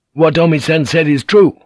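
put-on young Naga, high and loud and eager; exultant. A narrator's reading. Son answering his father at once, exultant. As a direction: blurt it out in triumph. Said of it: "Naga told him at once, exultantly".

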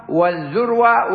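"والذروة" with a short u after the article; damma pronunciation. Shown as a demonstration. Teacher showing students the word's variants, damma in "والذروة", dhurwa. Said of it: "وضمها".

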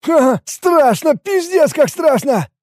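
high-pitched comic panic; frightened admission. A narrator's reading. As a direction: admit the terror in a high voice. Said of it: "признался он". (испуганное признание высоким голосом)

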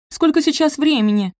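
high, loud urgent question; panicked demand. A narrator's reading. Sophie late, rousing sleepy Benedict, panicked demand. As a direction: demand the time in a fluster.

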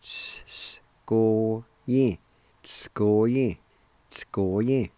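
"S-, s-" hissed long before "go-yin"; long whispered S then "go-yin"; drawn-out S. Shown as a demonstration. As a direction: stretch the S like two S's, then "go-yin". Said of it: "draw out the S sound just a little bit long".

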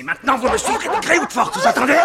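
high, very loud anger; furious threat. Acted. Scratched captor threatening to drag her off, furious shout. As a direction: shout the threat at full force.

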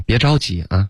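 ordinary talk

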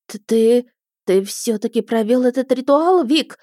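aghast at what he has done; gasping in shock. A narrator's reading. Wife learns her husband performed the ritual, aghast. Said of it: "ахнула женщина".

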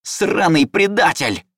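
high, loud, slow furious roar. A narrator's reading. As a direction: bellow it at the traitor. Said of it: "взревел лес".

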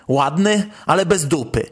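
ordinary talk, nothing marked

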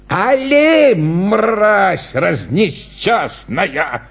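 high cry sinking into drawn-out abuse; exasperated clown. Acted. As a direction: start high, drag out the insult. Clown furious at stubborn dog. (злобно, протяжно, с отчаянием)

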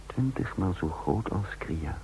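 slow, soft whisper of awe; hushed, impressed aside; awed whisper. A narrator's reading. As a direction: let the size sink in, whispering.

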